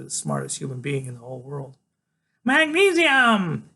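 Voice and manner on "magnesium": high, slow, excited shout; thrilled kid calling out loud; Freak. A narrator's reading. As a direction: shout it up high, stretching the syllables. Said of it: "he shouts".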